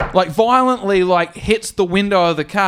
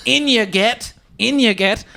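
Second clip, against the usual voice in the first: firm instruction; 7 semitones higher than usual. Retold or imitated. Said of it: "Ken shoves him into his car".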